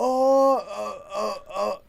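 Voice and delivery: put-on high, strained oh oh oh like a scared kid; mock panicked whimper. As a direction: ham up the frightened kid's yelps. Imitating terrified kid's reaction.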